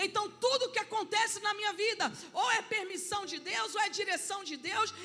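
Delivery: rising, loud preaching with shouted peaks; preaching at full force. Acted. Pastor preaching to the congregation, loud and fervent. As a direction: preach it out to the church, building.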